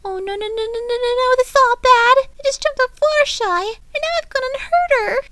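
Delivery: high, loud frantic rambling; panicked. A narrator's reading. Pinkie frantic after landing on Fluttershy, high loud panic. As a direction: spiral into panic. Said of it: "Pinkie thought distressingly".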